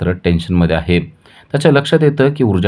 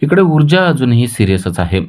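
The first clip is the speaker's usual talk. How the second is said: usual speaking voice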